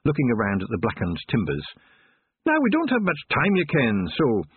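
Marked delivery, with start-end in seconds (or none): 2.5-4.4 lightly voiced Scottish Feegle; a wee Scots warrior, urgent. A narrator's reading. Rob Anybody's voice.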